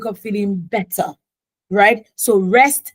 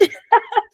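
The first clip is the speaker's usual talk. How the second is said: a quick giggle jumping up high; short high giggle. Real, not acted. Joking with her ladies about crying, a quick high giggle. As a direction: giggle at the thought.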